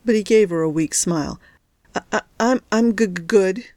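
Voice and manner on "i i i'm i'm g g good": teeth-chattering, stuttering through the cold; shivering stutter. Acted. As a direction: push the words out while shaking with cold. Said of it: "He was still shivering a little".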